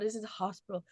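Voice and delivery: weak, slurred mumble, words barely formed; slurred lisp. Retold or imitated. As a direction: lisp it out as if half your face won't move. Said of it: "I could literally just lisp it out".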